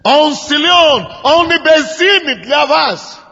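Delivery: ordinary delivery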